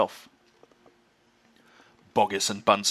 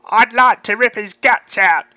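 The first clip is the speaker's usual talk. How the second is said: much higher, much louder savage snarl; vicious farmer; 11 semitones higher, 12 dB louder than usual. Acted. Bunce raging about the fox to the others, savage shout. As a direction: want that fox torn apart. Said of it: "said Bunce".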